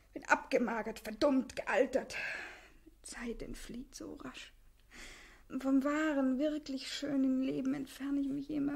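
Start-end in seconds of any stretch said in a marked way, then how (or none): 2.9-4.5 hushed and slow, drifting; a slow whispered sigh. Acted. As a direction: let it slip out in a whisper.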